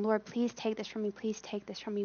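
hushed, understated plea; retold pleading prayer. Praying to the Lord for relief, quiet retold plea. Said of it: "crying out to him".

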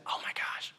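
breathy unvoiced "oh my gosh"; a shocked whisper to herself; whispered gasp. Telling the crowd about her own alarm, a shocked whisper. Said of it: "It's like".

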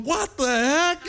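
high, slow, drawn-out disbelief; stunned coworker. Retold or imitated. Coworker seeing the diver spat out, high and stunned. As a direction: stretch it out in shock. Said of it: "he's going".

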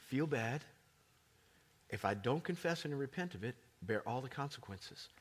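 usual speaking voice

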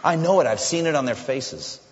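officer shouting at the pastor. Acted. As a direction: yell it at the calm prisoner. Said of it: "The officer shouted".

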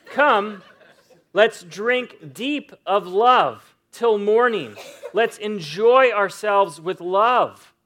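slow, laughing come-on read with a chuckle; seductive invitation. Acted.

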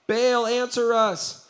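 high, drawn-out calling; mild mock shout. A narrator's reading. Prophets shouting to their god, mild high call.

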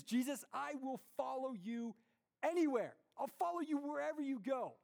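high, loud, gushing promise; hammed-up eager vow; over-the-top eager disciple. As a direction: play the overeager follower swearing loyalty. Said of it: "He's going over the top".

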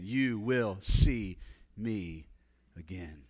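slow, low, soft scripture delivery; solemn, tender preaching. Acted. Jesus reassuring his disciples before leaving, solemn and tender.

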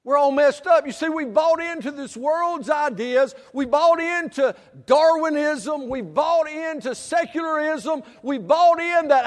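high, loud hammering sermon delivery; fiery preaching. Acted. Preacher scolding the church for worldly ideas, loud and fervent. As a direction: drive each "we bought into" home.

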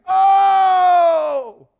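long, high, very loud imitated scream. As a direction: let out one full sustained shriek, mimicking him. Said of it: "they start screaming".

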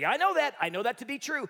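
high loud quick assurance; mock listener insisting they know; eager listener. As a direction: brush it off like a churchgoer who knows it all. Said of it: "And you're like".

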